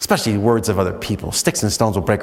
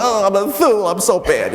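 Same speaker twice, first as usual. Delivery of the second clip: mock wounded self-pity; 9 semitones higher, 3 dB louder than usual. Someone else crushed by the insult.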